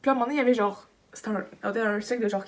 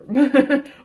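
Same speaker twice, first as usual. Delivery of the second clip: big real laugh, louder; loud burst of laughter; 4 semitones higher, 10 dB louder than usual. Real, not acted. Laughing at what someone said, loud laugh. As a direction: laugh out loud at the absurd line.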